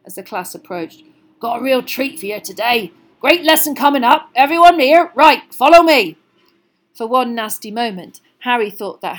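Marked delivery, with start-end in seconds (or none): 1.4-6.2 big booming hearty giant; a jolly loud gamekeeper; Hagrid voice. A narrator's reading.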